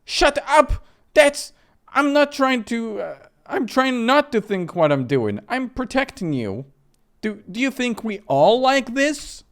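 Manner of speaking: high, loud, cracking outburst; cornered woman shouting back; desperate shout. Acted. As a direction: yell to drown out the guilt. Sister snaps back in standoff.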